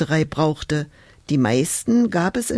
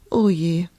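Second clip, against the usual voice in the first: sighing; 3 semitones higher, slower than usual. Acted. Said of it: "seufzte sie".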